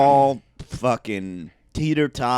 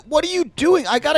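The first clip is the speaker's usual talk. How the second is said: exasperated high yelp; 3 dB louder, faster than usual. Real, not acted. Annoyed at cohost in camera.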